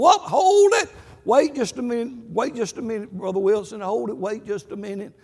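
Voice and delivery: sudden high loud whoop, then settling; a quick halt easing into a request; startled call to stop. Retold or imitated. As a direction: yank him back with your voice. Preacher grabs arm, stops him.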